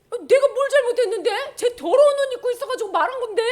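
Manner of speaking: very high, loud, taken-aback voice; squeaky acted bewilderment; startled protest. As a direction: protest innocence in alarm. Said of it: "사탄이 깜짝 놀랐겠지".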